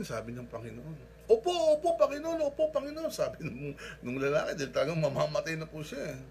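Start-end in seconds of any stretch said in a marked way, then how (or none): 1.3-3.1 high, loud, repeated yeses; frantic eager agreement. Retold or imitated. Dangling man assures God he trusts, in a frantic high shout. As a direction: agree desperately, calling upward.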